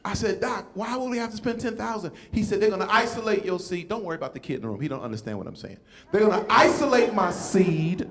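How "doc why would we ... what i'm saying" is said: ordinary talk, nothing marked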